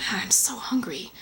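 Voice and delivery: hushed, slow hungry groaning to himself; acted hungry murmur. Man alone in his kitchen, hungry, hushed and slow. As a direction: play a hungry man, half-whispered and slow.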